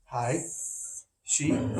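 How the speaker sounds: usual speaking voice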